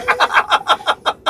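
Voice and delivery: squealing high laugh that falls away. Real, not acted. Son laughing at his mother's guess, squealing high. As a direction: let a high laugh tumble down.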